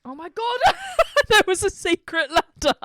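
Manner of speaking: loud, high disbelief breaking into laughter; real hysterical surprise; astonished outburst. Real, not acted. Real discovery of the missed ladder.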